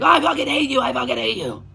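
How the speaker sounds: cartoonish hammed-up hatred; mock hateful outburst. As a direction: ham up a bitter breakup snap. Voicing a relationship blowing up.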